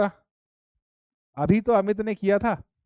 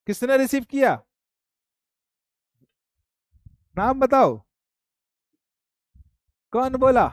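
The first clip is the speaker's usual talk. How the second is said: loud call-out; 5 semitones higher, 4 dB louder than usual. Real, not acted. Demanding who answered call.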